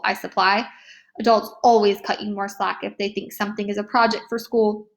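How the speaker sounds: plain reading voice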